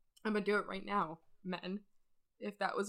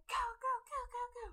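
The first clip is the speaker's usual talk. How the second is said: mock soldiers yelling. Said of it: "And they're like".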